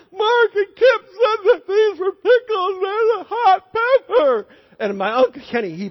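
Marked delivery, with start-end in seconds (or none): 0.1-4.5 much higher, loud, slow wailing complaint; crying child tattling on cousins; crying kid. Retold or imitated. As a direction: wail it like a burned eight-year-old. Kid crying from hot pepper.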